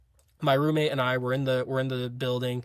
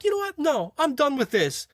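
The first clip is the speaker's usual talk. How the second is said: very high-pitched imitation of exasperation; mock fed-up instructor; 12 semitones higher than usual. Instructor done with smirking recruits, mock fed up. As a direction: cut them off in a raised mock voice.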